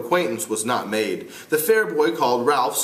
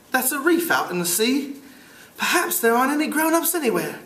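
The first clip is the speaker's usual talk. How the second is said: high British schoolboy voice; amateur British accent, pitched up; 12 semitones higher than usual. Acted. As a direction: play a piping British boy. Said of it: "try to do it with a British accent".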